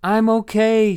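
high, loud reassurance called out; calling back. Acted. Rebecca answering Levi outside, loud call. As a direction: call back so they hear. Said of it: "she replied".

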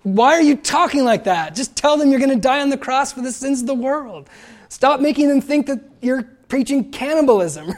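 high, loud exasperated plea; frustrated. Acted. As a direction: protest as if begging him to be plain. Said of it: "We might get frustrated and say".

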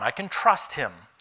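usual speaking voice

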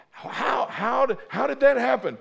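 high, rising amazed question; a loud how did that happen; disbelief. Retold or imitated. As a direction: ask it in loud astonishment. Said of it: "I'm like".

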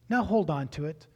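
ordinary talk, nothing marked

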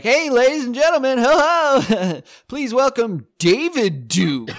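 loud, high showman intro; hammed-up emcee welcome; a comic playing a cheesy announcer; mock MC announcement. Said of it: "And I'm like".

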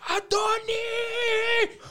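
very drawn-out high mock scream; exaggerated long screaming impression; imitating screaming mom. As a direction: mimic her screech, holding the last word. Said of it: "She's clearly screaming".

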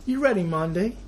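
high, jaunty fake British host; mock British. Host opens the discussion in a high mock British voice. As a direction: chirp it like a posh English presenter.

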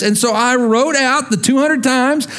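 ordinary talk

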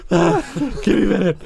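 high, winded voice through laughter; comically winded plea; winded runner. Acted. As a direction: play out of breath while laughing. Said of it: "you get winded".

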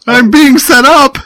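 high, loud hammed-up protest; loud mock protest. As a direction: cry out like a framed suspect.

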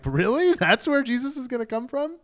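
high, rising disbelief; mock scandalized skepticism; incredulous disdain. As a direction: question it as if it's absurd. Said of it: "think of the disdain".

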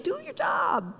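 very high, soft, sweet coaxing; exaggeratedly gentle, softened voice. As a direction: say it featherlight, high and hushed. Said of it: "He's gently saying".

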